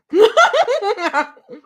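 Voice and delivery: a loud, high real laugh. Real, not acted. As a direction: burst out laughing. Laughs at own joke.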